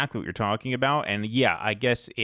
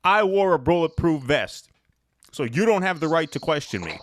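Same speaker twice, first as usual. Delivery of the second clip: higher, louder mock-indignant parody; mocking imitation; 6 semitones higher, 3 dB louder than usual. Host parodies the mayor's claim to be beyond questioning, mocking. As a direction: throw the boast back in mock outrage.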